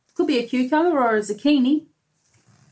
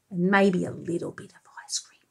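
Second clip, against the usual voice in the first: whisper; 5 semitones lower, 6 dB quieter, much faster than usual. Real, not acted. Whispered aside to child.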